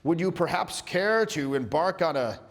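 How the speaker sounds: formal introduction. Acted. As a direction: announce yourself with overdone courtesy. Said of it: "if you said it like that".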